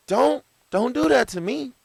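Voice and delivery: squeaky, high mock plea; high-pitched plea. Pleading reaction to a remark.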